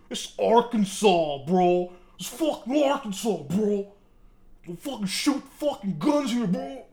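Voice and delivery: mock Southern accent.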